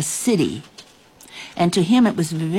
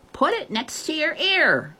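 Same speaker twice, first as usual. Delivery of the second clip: high, restrained faraway shout; distant calling; 10 semitones higher than usual. A narrator's reading. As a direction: call the instruction across a distance. Girl shouts instructions from afar.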